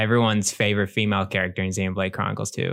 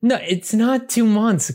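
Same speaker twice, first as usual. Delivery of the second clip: high, slow, disbelieving no; incredulous protest; 10 semitones higher, slower than usual. Real, not acted. Friendly argument over release date.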